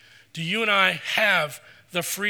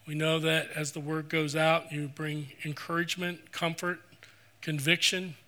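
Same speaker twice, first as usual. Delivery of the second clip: soft, reverent prayer voice; closing prayer; 3 semitones lower, 5 dB quieter than usual. Acted. Pastor leading the closing prayer, soft and reverent. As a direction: pray quietly and humbly for the congregation.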